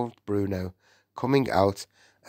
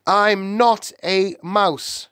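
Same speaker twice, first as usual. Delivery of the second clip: high, slow, emphatic protest; crying out in denial; 11 semitones higher, 4 dB louder, slower than usual. A narrator's reading. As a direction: cry out the denial word by word. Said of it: "he cried".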